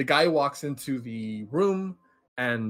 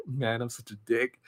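softer, half-hearted imitation of crying; mock crying; 5 dB quieter than usual. Guy watching the victims, lightly imitated crying. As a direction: retell a guilty man's tearful self-blame, lightly. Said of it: "like, crying".

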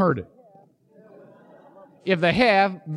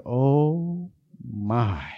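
put-on awed country kid; 8 semitones lower than usual. Kid awed by shiny car.

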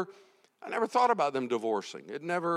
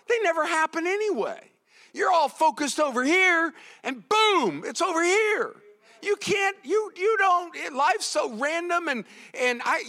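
higher, emphatic preacher's voice; impassioned preaching; 8 semitones higher, 3 dB louder than usual. Real, not acted. Preacher urging people not to worry about tomorrow, impassioned. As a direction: drive the point home with raised pitch.

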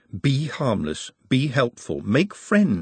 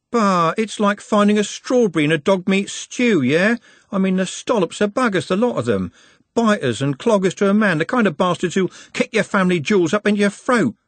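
high-pitched street-lad grumble; disgusted Trev, ranting; 10 semitones higher, 3 dB louder than usual. A narrator's reading. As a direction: rant scornfully about a rotten family.

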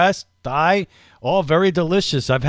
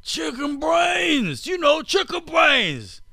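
high, drawn-out, hammed-up Australian accent; mock Australian accent; 14 semitones higher, slower than usual. Host quotes Australia's oldest man to cohost, hammed-up Australian accent. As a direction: cry it out slowly in a comically high Australian voice. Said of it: "that's how a guy from Australia sounds to me".

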